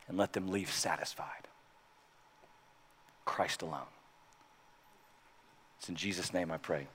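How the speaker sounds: soft, unvoiced whisper of a prayer; whispered prayer close. A pastor closing his prayer in a whisper before communion. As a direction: let the amen fade to breath.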